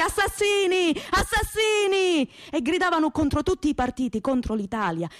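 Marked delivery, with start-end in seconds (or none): 0.0-2.3 howled accusation, much higher and stretched; crowd shouting. Acted. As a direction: hurl it at the police like a furious crowd. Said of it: "li chiamavano forte".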